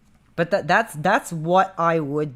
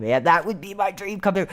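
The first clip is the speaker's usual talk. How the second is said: ordinary talk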